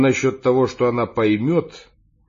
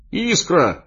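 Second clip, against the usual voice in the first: joyful shout of recognition; excited cry; 9 semitones higher, 4 dB louder than usual. A narrator's reading. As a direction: cry out a name, pitched high. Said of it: "закричал я". (радостный крик)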